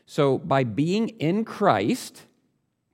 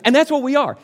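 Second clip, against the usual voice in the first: exclaiming; 12 semitones higher, 9 dB louder, faster than usual. Retold or imitated. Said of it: "And then he says".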